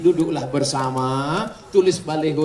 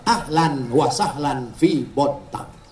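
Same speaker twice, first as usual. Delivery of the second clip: slow welcome; 5 semitones lower, much slower than usual. Acted. Welcome greeting, drawn out.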